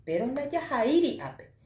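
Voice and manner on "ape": ordinary talk, nothing marked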